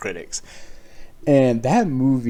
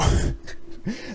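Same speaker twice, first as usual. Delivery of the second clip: laughing; 13 semitones higher than usual. Real, not acted. Laughs mid-story.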